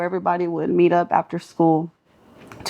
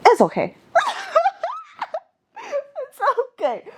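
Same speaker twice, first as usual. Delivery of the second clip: high laugh that tips into a cry; laugh, then let it crack into tears; laugh breaking into crying. Real, not acted. Remembering the fight, she laughs then cries.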